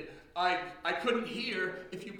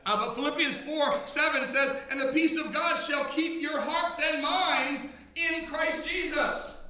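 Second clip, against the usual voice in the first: fervent, raised preaching voice; full-force sermon delivery; 4 semitones higher, 4 dB louder than usual. Acted. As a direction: preach it to the congregation. Preacher back in full flow.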